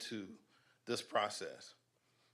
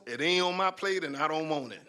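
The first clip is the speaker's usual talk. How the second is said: louder, higher, punchy declaration; raised-voice blunt brush-off; emphatic refusal; 6 semitones higher, 8 dB louder than usual. Real, not acted. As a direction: lay it down loud and high, refusing outright. Emphatic refusal to comment, audience laughs.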